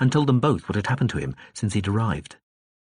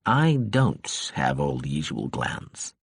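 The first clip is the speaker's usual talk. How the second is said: slow, low and tired; suddenly worn-out drunk ambassador; weary; 7 semitones lower, slower than usual. A narrator's reading. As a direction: let the energy drain out of him. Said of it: "in a suddenly weary voice".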